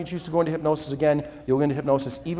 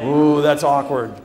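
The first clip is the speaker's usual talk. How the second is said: amused awkwardness; 3 semitones lower, 6 dB louder than usual. Real, not acted. Laughs at failed hypnosis.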